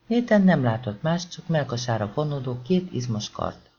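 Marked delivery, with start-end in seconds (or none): none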